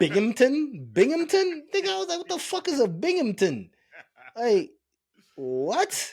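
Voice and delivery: high, loud comic disbelief; incredulous. Retold or imitated. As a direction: repeat it as if it's absurd. Retold disbelief at Binghamton.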